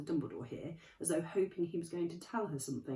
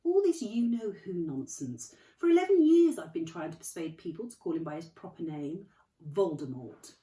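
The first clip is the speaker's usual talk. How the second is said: kindly Dumbledore; 3 semitones higher, 8 dB louder than usual. Acted. Dumbledore voiced, gently chiding.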